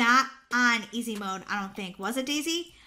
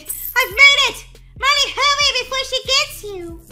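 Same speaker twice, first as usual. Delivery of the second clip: urgent excited shouting; 11 semitones higher, 9 dB louder than usual. Real, not acted. Player urging Molly to hurry from Granny, urgent excited shouting. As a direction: holler it in a thrilled panic.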